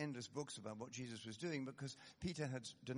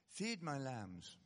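slow, deliberate; much slower than usual. Retold or imitated. Jesus entrusting Peter with his flock, slow and deliberate. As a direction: stretch each word out slowly.

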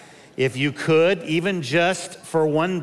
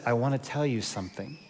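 hushed wife voice, softer; soft confiding; 2 semitones lower, 7 dB quieter than usual. Retold or imitated. Wife in bed at night, telling her husband something, hushed. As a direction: quietly break news in bed.

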